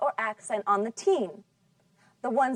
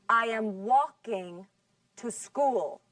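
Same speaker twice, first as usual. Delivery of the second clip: slow, with the weight on "walking" and "school"; correct sentence stress; slower than usual. Shown as a demonstration. Teacher models the sentence slowly for Spanish-speaking students, light on "I am" and "to".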